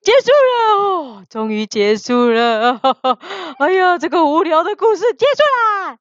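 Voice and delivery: lively, high-starting wrap-up with laughter; relieved announcement. Real, not acted. Storyteller ends the silly story, relieved and laughing.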